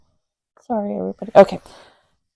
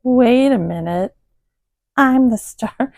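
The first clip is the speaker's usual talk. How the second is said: higher, louder, playful voice for a pet; a hint of attention-seeking cat; lightly voiced indignant cat; 7 semitones higher, 5 dB louder than usual. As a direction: voice a cat claiming the spotlight, lightly. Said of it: "somebody in the background who's like".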